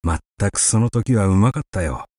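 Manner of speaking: usual speaking voice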